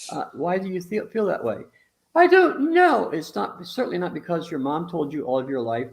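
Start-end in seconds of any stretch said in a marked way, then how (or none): 2.1-3.1 put-on high, loud little kid answer; child's high reply. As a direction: whine it like a cornered kid.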